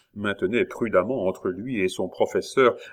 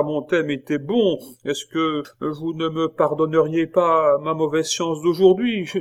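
higher schoolboy voice, wheedling; Pitou pleading; 7 semitones higher, 5 dB louder than usual. A narrator's reading. Pupil wheedling his teacher for forgiveness. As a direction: coax the teacher for another chance.

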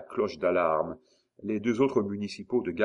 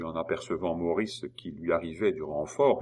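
plain reading voice

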